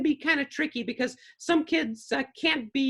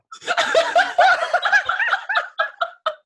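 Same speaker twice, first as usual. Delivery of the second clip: big real laugh; 7 dB louder than usual. Real, not acted. Puppeteer cracks up at own story.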